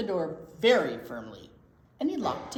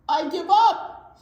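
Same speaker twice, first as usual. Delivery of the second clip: blubbered; 9 semitones higher, 11 dB louder than usual. Acted. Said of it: "he blubbered".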